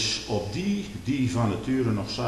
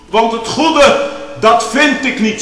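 loud, higher, slow pulpit cry; fervent preaching; 10 semitones higher, 11 dB louder, slower than usual. Acted. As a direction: cry Paul's words out to the church. Preacher quoting Paul fervently. (luide, vurige preek)

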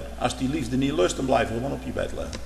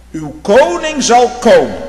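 loud, higher, slow proclamation of "Uw koning zal komen"; proclaimed; 10 semitones higher, 12 dB louder, slower than usual. Real, not acted. Preacher announcing the king to his congregation, proclaiming loudly.

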